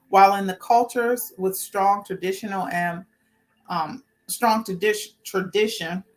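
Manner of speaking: normal talking voice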